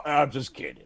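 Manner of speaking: normal talking voice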